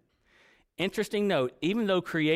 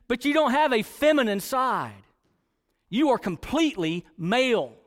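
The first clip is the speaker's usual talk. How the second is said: raised, higher-pitched preacher's insistence; forceful preaching; 6 semitones higher, 4 dB louder than usual. Real, not acted. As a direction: press each point home to the congregation.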